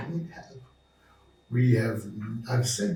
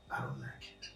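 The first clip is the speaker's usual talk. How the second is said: quiet calm refusal; 15 dB quieter than usual. Shown as a demonstration. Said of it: "say it in a cool manner".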